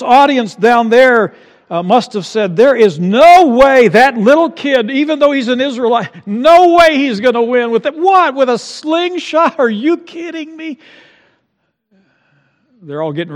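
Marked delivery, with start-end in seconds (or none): 2.6-10.8 high, loud scoffing disbelief; mock the boy's chances; scoffing onlooker. Doubting crowd voiced.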